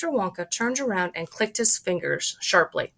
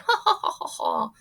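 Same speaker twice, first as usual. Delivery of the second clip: high burst of laughing ha ha; Oompa Loompa laughter; 13 semitones higher than usual. Acted. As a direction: explode into laughter. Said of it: "exploded into pearls of laughter".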